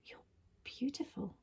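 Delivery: very soft breathy whisper; tender near-silent whisper. A narrator's reading. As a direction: barely breathe it to him. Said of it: "Mina whispered it".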